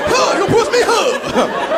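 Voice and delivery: a soul shout that comes out thin and high. Retold or imitated. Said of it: "I was like a weak-ass [name]".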